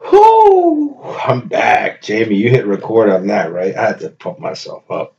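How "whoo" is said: loud, very high whoop; excited whoop. Real, not acted. Pumping himself up after the break, loud high whoop. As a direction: let out a hype whoop.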